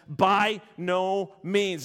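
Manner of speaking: By no means drawn out very slowly, each word weighted; slow it right down and plant each word; emphatic. Acted. Preacher quotes Paul's answer, very slow and emphatic. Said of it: "This is incredibly emphatic".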